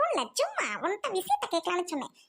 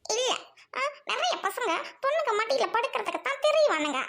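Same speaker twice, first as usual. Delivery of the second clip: ordinary talk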